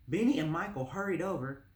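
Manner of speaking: usual speaking voice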